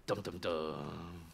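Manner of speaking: low, slow dramatic dun-dun-dun; mock suspense music, low and slow; ominous low suspense hum; ominous dum dum dum.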